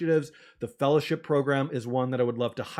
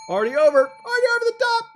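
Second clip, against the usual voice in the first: much higher, louder, fast; thrilled shout; 9 dB louder, much faster than usual. Real, not acted. Host telling chat the goal is already beaten, thrilled. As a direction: burst out in delight.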